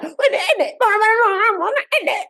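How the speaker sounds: quick, imitated London accent; a light imitation of a London accent; mock London accent. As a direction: parody a Londoner's "innit". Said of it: "they be like".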